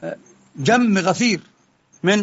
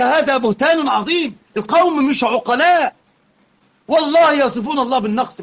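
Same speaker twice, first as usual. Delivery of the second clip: indignant outcry; 6 semitones higher than usual. Real, not acted. Denouncing opponents.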